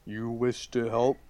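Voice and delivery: talking tree. Acted. DM voicing the tree.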